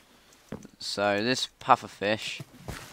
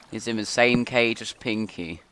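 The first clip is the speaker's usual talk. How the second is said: normal talking voice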